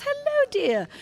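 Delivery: very high English lady greeting; high-pitched, put-on British woman. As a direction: trill it like a sweet English lady. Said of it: "she goes".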